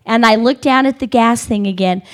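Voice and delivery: ordinary delivery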